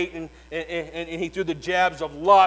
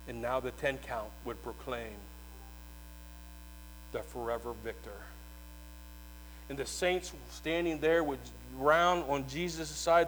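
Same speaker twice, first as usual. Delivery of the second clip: hushed sermon storytelling; 5 semitones lower, 9 dB quieter than usual. A narrator's reading. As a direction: draw the congregation in with a hush. Hushed telling continues.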